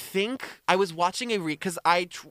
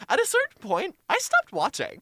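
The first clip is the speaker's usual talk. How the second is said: much higher, put-on delivery; dramatic restart; 13 semitones higher than usual. Host restarting the story from there, high and theatrical. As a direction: pitch it right up for a mock-dramatic opening.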